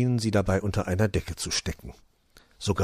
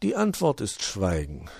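plain reading voice

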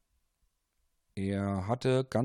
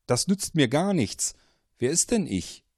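higher, louder exasperated question; irritated confusion; 6 semitones higher, 5 dB louder than usual. Acted. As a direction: push back, baffled. Paul baffled by caller.

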